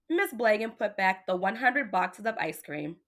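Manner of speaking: ordinary delivery, nothing marked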